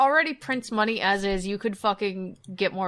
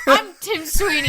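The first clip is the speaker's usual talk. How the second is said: louder, proud mock announcement; mock boss reveal; 5 semitones higher, 6 dB louder than usual. Tim Sweeney revealing himself to the staff, mock proud. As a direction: unmask yourself as the boss. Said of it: "He takes off his mic".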